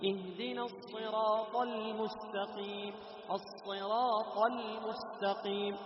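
chanted, ل in المستقيم held in measure; moderate ل. Shown as a demonstration. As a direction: recite with a moderately flowing ل in المستقيم. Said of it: "لاحظ اللام حق المستقيم". (ترتيل مع لام متوسطة في المستقيم)

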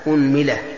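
"أنملة" said as unmila; opening u, middle i. Shown as a demonstration. Teacher lists variants for students, "أنملة" as unmila. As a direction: say "أنملة" with damma on hamza, kasra on mim. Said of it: "ضم الهمزة".

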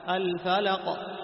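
الفلق with the final qaf bouncing, no vowel colour; slow and loud, the qaf in الفلق shaken in its place; qalqala on الفلق. Shown as a demonstration. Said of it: "لاحظ".

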